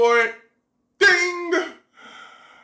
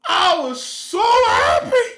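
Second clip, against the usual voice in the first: high, louder, slow, beaming; overjoyed; 9 semitones higher, 4 dB louder, slower than usual. Real, not acted. Thrilled about the cheap iPhone, slow beaming joy. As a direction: draw it out, glowing with joy. Retelling the deal.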